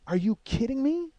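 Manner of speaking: high, incredulous question; retold disbelief. Storyteller replaying his reaction to the fire, incredulous. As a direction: voice climbing in mock disbelief.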